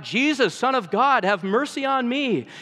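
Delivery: loud, high cry for mercy. A narrator's reading. Blind beggar to Jesus passing by, crying out. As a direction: call out urgently over a crowd. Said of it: "so he cried out".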